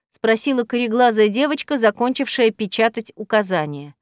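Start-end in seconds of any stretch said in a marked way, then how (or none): none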